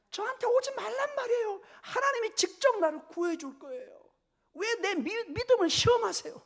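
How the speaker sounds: very high, loud indignant outburst; acted angry refusal. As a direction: act out a man shrilly sending help away. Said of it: "막 화를 내면서". (높고 큰 소리로 화내는 흉내)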